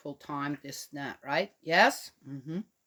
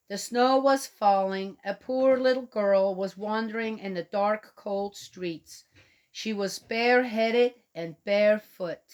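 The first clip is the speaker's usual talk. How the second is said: storybook reading, a touch higher and louder; story narration; 5 semitones higher, 4 dB louder than usual. Acted. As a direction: read the tale to the little ones. Reads the story aloud.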